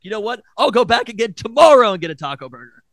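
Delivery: worked-up retold vow; defiant comeback. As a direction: throw it back loudly at the whole household. Acted comeback in retold family fight.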